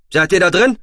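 high, loud rising question; calling through a door. A narrator's reading. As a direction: shout to find who is inside. (laut und hoch fragend gerufen)

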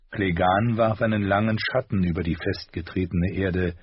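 usual speaking voice

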